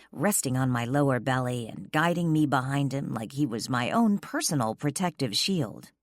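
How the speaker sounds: ordinary delivery, nothing marked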